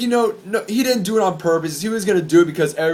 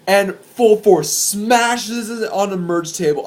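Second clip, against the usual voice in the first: usual speaking voice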